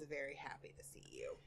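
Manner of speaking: soft unvoiced whisper. Real, not acted. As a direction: whisper it so the dog won't hear. Whispering about the dog.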